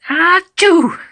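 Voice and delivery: big high pretend achoo; loud sneeze. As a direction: burst out with a sneeze. Kitten imagines sneezing.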